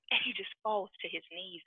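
ordinary talk

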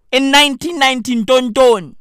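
ordinary talk, nothing marked

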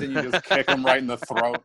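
normal talking voice

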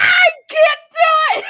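high, loud, crying mock wail; scared wail. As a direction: wail like a kid who lost her nerve. Scared child backing out.

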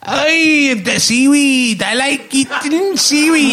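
very high, slow mock Welsh; hammed-up singsong Welsh accent. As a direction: stretch out an overdone Welsh accent, pitched high. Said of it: "Chris Evans from Wales".

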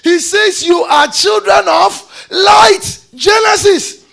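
high, loud proclamation of scripture; fiery pulpit delivery; full-force preaching. Real, not acted. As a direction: drive the verse home in ringing high shouts.

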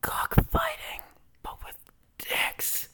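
ASMR whisper into the mic; sweet nothings. Acted. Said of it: "We are going to whisper sweet nothings".

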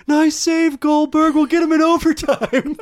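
high, loud, bright coach cheer; Coach Bombay impression. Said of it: "the words of Emilio Estevez, our Coach Bombay".

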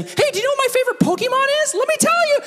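put-on suddenly chipper kid, very high and fast; Dana. Kid forgetting his tears to chat Pokemon, high and chipper. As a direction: chirp it eagerly in a mock child's voice. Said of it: "he turns off the tears".

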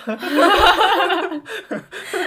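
loud high-pitched laughter. Real, not acted. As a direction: burst out laughing. Laughing at translated slogan.

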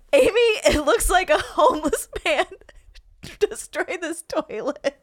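high, loud, laughing quote; quoting, laughing. Retold or imitated. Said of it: "the quote is".

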